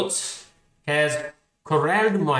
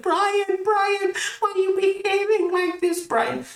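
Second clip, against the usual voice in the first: very high falsetto mom imitation; badly faked mom voice, way up high; Linda. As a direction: put on a high-pitched mother scolding her kid. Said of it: "That's my imitation of Linda".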